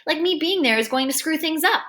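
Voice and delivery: usual speaking voice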